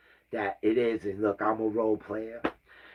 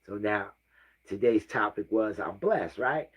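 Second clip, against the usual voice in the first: ordinary delivery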